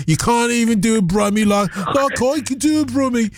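mock Brummie. Boasting in Brummie.

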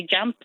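"jump" pronounced "jamp"; Inverness dialect jamp. Shown as a demonstration. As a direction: give "jump" an A vowel, as in "lamp". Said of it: "in Inverness they say".

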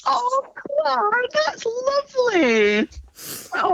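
high, touched, near crying; tearful. Real, not acted. Mum moved by her son's letter, tearful. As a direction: melt into happy tears, drawing it out. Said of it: "is having a little cry".

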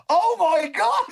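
shrieked high mock astonishment; crowd gasp. Crowd seeing Chris Hoy's legs, high and amazed. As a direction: squeal it like a shocked crowd.

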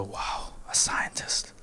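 hushed, breathy wow; awed whisper. Retold or imitated. Comedian whispering in awe at the audience member. Awed reaction retold on stage.

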